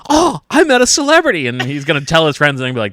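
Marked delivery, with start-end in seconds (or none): 0.1-1.5 starstruck fan voice. Retold or imitated. Imitating the starstruck waiter.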